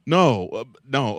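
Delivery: normal talking voice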